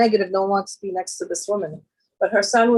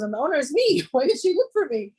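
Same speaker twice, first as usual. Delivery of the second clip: high-pitched, incredulous questioning; retold indignation; 6 semitones higher than usual. Woman sees her lost earrings on a nurse, indignant high questioning. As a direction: act out baffled outrage, pitch raised.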